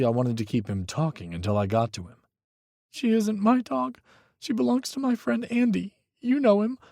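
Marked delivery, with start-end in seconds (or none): 2.9-6.8 put-on high, tense young voice; a frightened young man pleading on the phone; young man, scared. A narrator's reading. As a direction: Micah telling Hawk his ex is dangerous, high and scared. Micah's voice.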